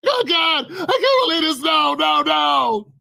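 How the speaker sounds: high, shouted terrified cries; panicked victim. Acted. Victim stuck in the chair crying out in fear, high. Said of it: "You're stuck to jail like".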